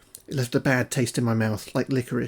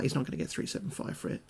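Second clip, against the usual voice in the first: voiceless, much softer, fast; whispered scoff; 11 dB quieter, faster than usual. Retold or imitated. Scoffing at the landlord's asking price, whispered. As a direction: whisper it quickly. Muttered retort about price.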